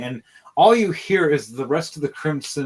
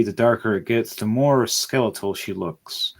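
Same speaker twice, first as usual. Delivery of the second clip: usual speaking voice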